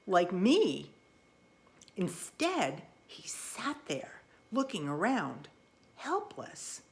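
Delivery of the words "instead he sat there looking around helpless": whispered, much softer tension; hushed. A narrator's reading. Narrator frog dreads Pin's fate, whispering.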